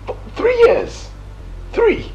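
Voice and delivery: outraged. Acted. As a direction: shriek the number in outrage. Outraged at being fooled.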